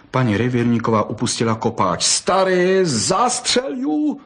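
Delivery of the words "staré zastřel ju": high, slow desperate plea; desperate cry. A narrator's reading. Forester's wife begs husband to shoot the fox, high wail. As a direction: wail it at her husband, far higher. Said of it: "zvolala zoufale".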